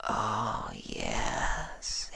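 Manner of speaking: breathy unvoiced whisper; whispered oh yes. Contented listener whispering on the inhale. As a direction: hush it out on a breath.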